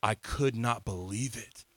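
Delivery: ordinary talk, nothing marked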